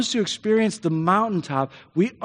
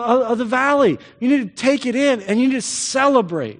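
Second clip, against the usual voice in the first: urgent exhortation; 6 semitones higher than usual. Pretend. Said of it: "Paul says".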